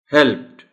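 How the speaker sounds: helped said with a final T sound; helped with a T at the end; model pronunciation. Shown as a demonstration. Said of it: "the word will be pronounced as". (helped आखिर में टी की आवाज़ के साथ)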